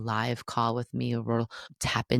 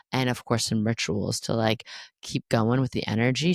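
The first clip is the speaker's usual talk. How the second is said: usual speaking voice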